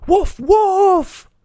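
very high-pitched false alarm; high storybook cry, last word drawn out; shouting. A narrator's reading. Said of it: "started hitting the sticks together and shouting".

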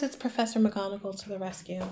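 normal talking voice

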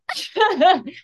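high, hammed-up bawling sobs; theatrical burst of sobs; mock wailing. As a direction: act out dramatic crying on your knees. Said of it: "onto my knees crying".

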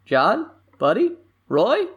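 rising question on each name; names called as separate, climbing questions; questioning calls. Acted. Character tentatively checks for others by name, rising questioning calls.

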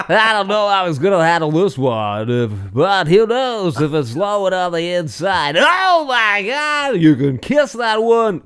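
high, loud, drawn-out play-by-play; over-the-top home run call; cheesy baseball announcer. Retold or imitated. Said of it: "That's exactly how he sounded".

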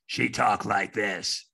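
raspy smoker's voice of a brutal phone operator; Madge's raspy voice. Retold or imitated. Imitating raspy Madge to the host. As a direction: rasp it out like a three-pack-a-day smoker. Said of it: "she had this really raspy voice".

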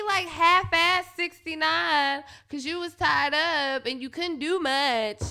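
normal talking voice

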